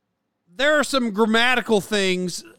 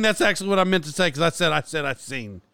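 ordinary delivery

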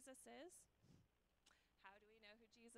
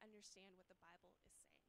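whispered; 5 semitones lower, 8 dB quieter than usual. Real, not acted. Sermon line trailing off.

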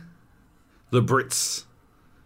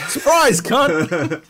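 high, loud taunting surprise; spring it on them with a taunt; hammed-up invader taunt; cheeky gloat; 8 semitones higher, 6 dB louder, much slower than usual.

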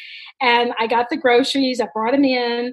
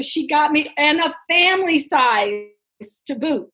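ordinary talk, nothing marked

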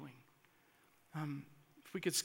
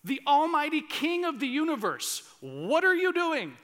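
loud challenge; 10 semitones higher, 10 dB louder than usual. Acted. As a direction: demand an answer from God at full voice. Voicing bold cry to God.

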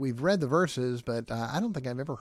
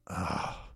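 low, soft, drawn-out groan; Jesus; 7 semitones lower, 6 dB quieter than usual. Acted. Preacher asking listeners to picture it, low imitated groan. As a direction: groan quietly and deeply. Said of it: "picture Jesus groaning".

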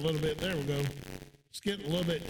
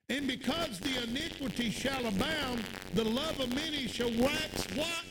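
higher, fervent pulpit voice; preaching; 5 semitones higher than usual. Acted. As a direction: preach it with rising fire. Preaching at full force.

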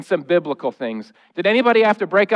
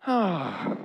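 sad sigh; 9 dB quieter than usual. Shown as a demonstration. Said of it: "A sad noise might sound like".

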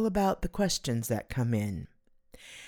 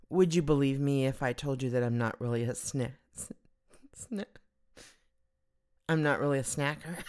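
ordinary talk

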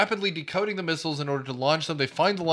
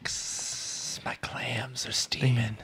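whisper; 6 semitones lower, 5 dB quieter, much slower than usual. Acted. Quoting movie line, whispered.

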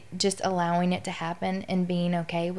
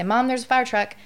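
put-on child's warning; 6 semitones higher, 6 dB louder than usual. Little kid warning mom.

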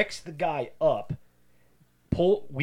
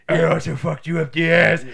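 lightly put-on voice, louder; hammed-up skit villainess; 3 semitones higher, 7 dB louder than usual. Talia confessing to Batman in a retold skit, hammed-up. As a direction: imitate a melodramatic confession.

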